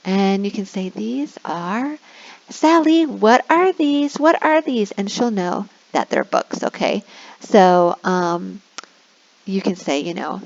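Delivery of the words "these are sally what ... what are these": put-on higher, louder, slow prompting of a child; kindergarten teacher voice. Shown as a demonstration. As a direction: coax a small child to answer.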